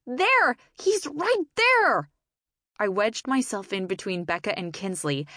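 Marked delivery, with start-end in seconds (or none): 0.1-2.1 high, loud thrilled scream. A narrator's reading. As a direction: scream it out, pointing. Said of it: "Michelle screamed".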